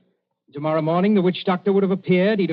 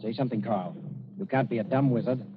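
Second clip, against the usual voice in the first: low, softer hissed urging; urgent undertone; 5 semitones lower, 8 dB quieter than usual. Acted. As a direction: press him quietly so Nobi won't hear. Urging accomplice secretly.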